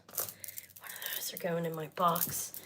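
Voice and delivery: hushed whispered aside, slow; whispering. Real, not acted. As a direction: whisper it to yourself as a secret. Aside about keeping one.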